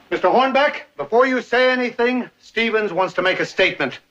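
usual speaking voice

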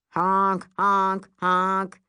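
three loud, evenly spaced horn blasts; imitated car honks. Street traffic spoiling the romance, honking. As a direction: honk insistently like a car. Car horns interrupt the scene.

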